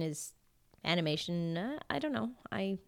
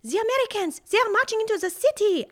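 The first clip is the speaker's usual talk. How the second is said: much higher, louder tiny voice; little dubbed voice; 9 dB louder than usual. Retold or imitated. Mocking the dubber who replaced his line, much higher. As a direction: squeak it like a small dubbed-in stranger. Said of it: "this little voice comes in going".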